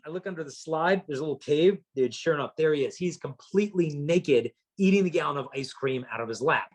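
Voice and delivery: normal talking voice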